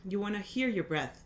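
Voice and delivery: soft, calm breathing guide; guided meditation. Acted. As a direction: gently guide each breath.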